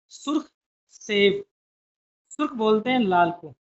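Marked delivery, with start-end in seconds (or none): 0.1-1.4 drawn-out teacher reading, word by word; slow reading drill. Shown as a demonstration. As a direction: read it slowly so pupils can follow. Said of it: "इसको पढ़ेंगे आगे".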